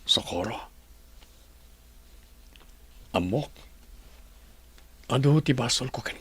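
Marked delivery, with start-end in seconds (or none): 0.0-3.5 unvoiced, breathy whisper of her name. Husband alone, remorseful. (agar-arasaas)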